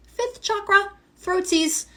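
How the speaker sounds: squeaky cutesy high tone; sing-song it, cute and high; playful high voice. Real, not acted. Playful nickname for the chakra.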